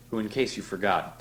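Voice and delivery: plain reading voice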